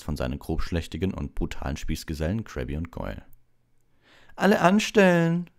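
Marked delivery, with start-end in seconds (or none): 4.4-5.5 loud, high call to a crowd; loud jeering announcement; booming Malfoy. A narrator's reading. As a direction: holler it mockingly to everyone. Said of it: "dröhnte Malfoy in die Menge".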